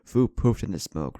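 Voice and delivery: plain reading voice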